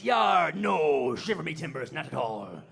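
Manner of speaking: hammed-up pirate voice failing at Irish accent; bad pirate-Irish accent. Buddy asked to do Irish, blustering pirate accent instead.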